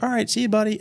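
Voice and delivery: high friendly goodbye to a dog; talking to a dog. Retold or imitated. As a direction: wave the dog off lightly. Saying bye to a dog.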